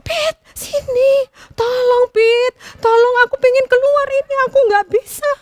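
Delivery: high, loud panicked begging; trapped bulbul shouting to the sparrow for help; desperate pleading. A narrator's reading. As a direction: beg frantically from inside the trap. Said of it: "dia langsung teriak".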